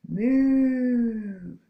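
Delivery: loud long mooing; big cow moo. Pretend. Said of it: "What noise does a cow make?".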